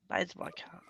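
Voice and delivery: quiet whispered joke; half-whispered quick aside. Real, not acted. Joking aside to self.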